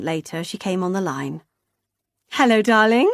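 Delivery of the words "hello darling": louder, doting mum; mother's voice. A narrator's reading. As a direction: greet your daughter sweetly. Mother on the phone.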